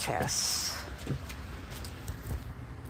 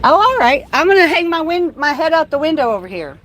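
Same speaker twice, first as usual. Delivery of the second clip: louder and higher, fast at the end; calling out the window; 9 semitones higher, 9 dB louder, faster than usual. Real, not acted. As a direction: raise your voice to reach the officer. Talking out the window.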